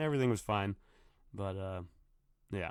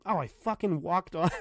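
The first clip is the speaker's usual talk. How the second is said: laughing; 9 semitones higher, 5 dB louder than usual. Real, not acted. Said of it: "laugh heard".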